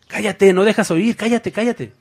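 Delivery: mock-annoyed bystander telling him to shut up; annoyed crowd. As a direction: shush the beggar so you can hear the teacher. Crowd hushing the shouting beggar.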